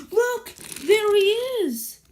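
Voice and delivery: high, loud cry of discovery; excited shout. Acted. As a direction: point and call out high in alarm. Spotting the ghost, calling out.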